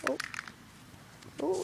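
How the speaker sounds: ordinary talk, nothing marked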